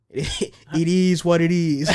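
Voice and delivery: drawn-out, slow "it is what it is"; flippant shrug. Retold or imitated. Failing student to teacher, flippant and drawn out. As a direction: stretch it out like a careless verbal shrug.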